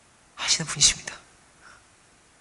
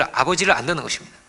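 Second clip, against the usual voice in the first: normal talking voice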